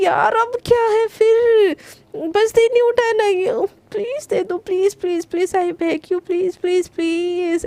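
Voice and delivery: high, whiny pleading; begging. A narrator's reading. Garima begging her sister for the phone, whiny and high. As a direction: beg for the phone over and over. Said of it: "ऐसे बोल के ना".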